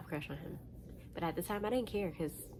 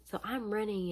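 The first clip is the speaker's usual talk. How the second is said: ordinary talk, nothing marked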